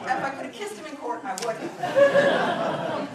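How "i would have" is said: very slow and louder, laughing through it; delighted laugh stretching the words. Real, not acted. Could have kissed the doctor in court, laughing.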